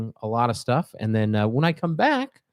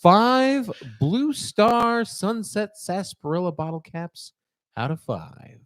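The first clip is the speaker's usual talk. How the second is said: announcer; 6 semitones higher, 4 dB louder, slower than usual. Acted. Formal rating announcement.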